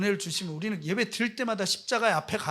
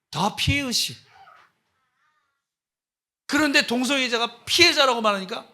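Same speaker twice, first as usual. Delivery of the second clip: ordinary delivery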